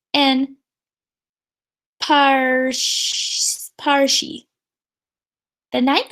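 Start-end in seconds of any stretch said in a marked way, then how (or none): none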